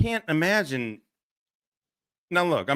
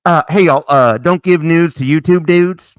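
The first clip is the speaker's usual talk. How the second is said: loud, hammed-up proclamation; quoted movie line; 4 semitones higher, 13 dB louder than usual. Host quoting Old School to his chat, loud and hammy. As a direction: announce it to the whole room. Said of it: "Remember Old School?".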